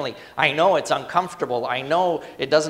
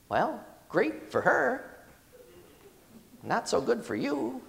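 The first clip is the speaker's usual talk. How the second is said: sarcastic retort; 9 semitones higher, 3 dB quieter than usual. Acted. Pastor mocks a weak excuse.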